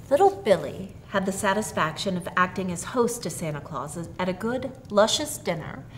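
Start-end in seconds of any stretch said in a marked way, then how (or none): none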